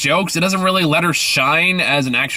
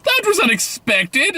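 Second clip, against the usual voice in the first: high-pitched mock movie-line delivery; exaggerated, squeaky imitation of an actress; Anna Hathaway; much slower than usual. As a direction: put on a very high voice, mocking the line. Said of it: "Anna Hathaway goes".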